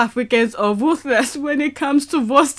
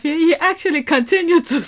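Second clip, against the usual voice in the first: normal talking voice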